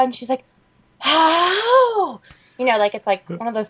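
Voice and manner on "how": drawn-out high-pitched disbelief; comic high old-lady bewilderment; baffled grandma impression. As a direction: wail it like a mystified grandmother.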